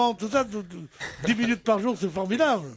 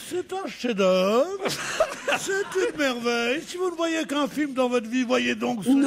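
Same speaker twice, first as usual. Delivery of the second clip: gushing quote. Retold or imitated. Concierge raving about a film to a hesitant moviegoer, mock-gushing. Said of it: "et qu'est-ce qu'elle dit".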